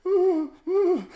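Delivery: very high, quavering "ooh"; mock terrified whimper. Villagers cowering from the stranger, comic imitation of terrified squeals. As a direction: imitate frightened people whimpering, with amusement. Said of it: "see the absolute terror".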